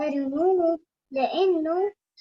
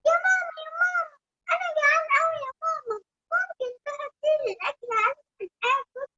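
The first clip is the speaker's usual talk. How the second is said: put-on high, fast, whiny child voice; little bunny voice; 11 semitones higher, faster than usual. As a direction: squeak it like a hungry bunny.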